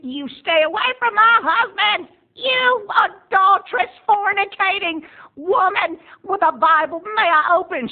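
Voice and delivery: very high, loud, slow furious shriek; lost it. Retold or imitated. Roberta lashing out at her sister over her husband, shrieking. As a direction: scream it like a woman who has lost it. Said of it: "she has lost it".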